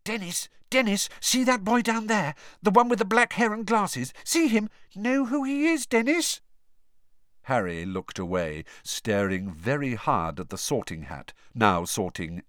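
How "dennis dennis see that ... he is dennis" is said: high, breathless schoolboy voice; an excited kid nudging his brother; eager young boy. Acted. Excited boy points out Harry.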